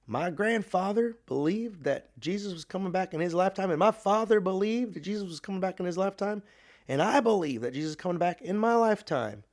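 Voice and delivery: put-on old-time revival preacher, pitched high and insistent; emphatic Southern preacher. Good old boy preacher at a camp meeting, emphatic. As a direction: proclaim it in a high, rising Southern preacher's cadence. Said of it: "he said emphatically".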